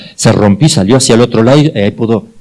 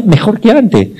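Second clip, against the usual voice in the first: laughing; 11 semitones higher, 3 dB louder than usual. Real, not acted. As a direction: laugh through the words, high and slow. Remate gracioso del milagro. (riéndose del final feliz)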